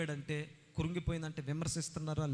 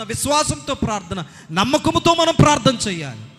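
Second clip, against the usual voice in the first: full-force preaching; 8 semitones higher, 21 dB louder than usual. Acted. Preacher urging the congregation to pray to God, full-force preaching. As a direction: proclaim it to the whole church.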